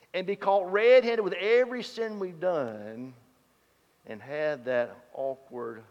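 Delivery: ordinary delivery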